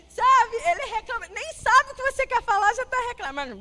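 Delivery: falando entre risadas, mais alto e agudo; rindo de verdade. Real, not acted. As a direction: solte a risada e fale rindo. Ri contando sobre o filho. (rindo ao contar do filho que reclama de tudo)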